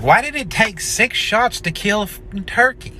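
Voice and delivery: high, anxious questioning; worried, rising fret; paranoid dad. Retold or imitated. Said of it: "He's like".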